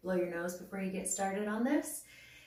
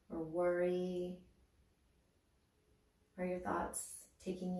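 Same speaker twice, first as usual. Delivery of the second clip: guided meditation; 6 dB quieter than usual. Acted. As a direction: guide them gently inward, unhurried. Leads a body-awareness meditation.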